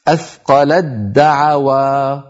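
slow athqalad-da'awa for أثقلت دعوى; no t in أثقلت, one doubled d; ta merged into dal. Shown as a demonstration. Teacher reciting the example, doubled d. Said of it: "إدغام التاء في الدال".

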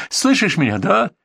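high, edgy demand rising at the end; nervous shout. A narrator's reading. Bandit checks he was heard, nervous and high. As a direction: push for an answer, pitch climbing. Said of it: "кричал нервно Кацо".

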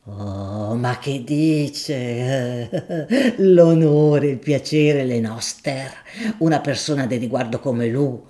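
a deferential, earthy shopkeeper making his offer; grocer Gaudenzio. Acted. Gaudenzio humbly deflects.

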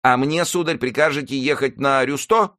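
high-pitched servant's question. A narrator's reading. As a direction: ask the master in a high voice. Servant character anxiously asks his master. (высокий голос слуги)

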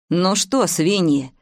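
plain reading voice

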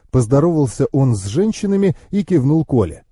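plain reading voice

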